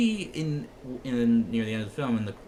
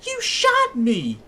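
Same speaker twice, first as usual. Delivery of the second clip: imitating a stunned yell, much higher and louder, slowly; mock outrage at being shot, dragged out high; shocked outcry; 12 semitones higher, 8 dB louder, much slower than usual. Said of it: "and he goes".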